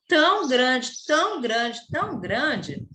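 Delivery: "tão grande" stressed high and loud at first, then sinking back down; emphatic repetition, fading. Acted. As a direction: lean hard on "tão", then let it fade. Complains the page is too short. ("tão grande" enfático, diminuindo)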